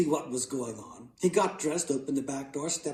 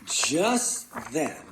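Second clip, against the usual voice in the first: ordinary delivery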